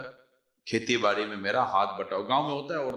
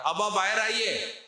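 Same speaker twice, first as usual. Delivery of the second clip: high, slow call across the house; 8 semitones higher than usual. Retold or imitated. Wife calling husband out of hiding, raised call. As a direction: summon him from the room.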